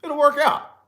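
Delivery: high, light, smiling imitation of a relaxed friend; smiling easygoing friend. Friend Case shrugging off the lost ID, smiling and high. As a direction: breezily wave off the worry with a smile. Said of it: "he would just smile and be like".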